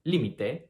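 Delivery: límite stressed as LI-mi-te; first-syllable stress. Shown as a demonstration. Teacher contrasting three forms, límite as LI-mi-te. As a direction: hit LI at the start of límite. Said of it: "which all mean different things".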